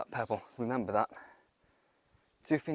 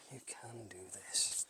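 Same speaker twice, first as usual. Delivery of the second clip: slow, softer whispered pep talk; 9 dB quieter, slower than usual. Real, not acted. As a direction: breathe the words out slowly. Psyching self up.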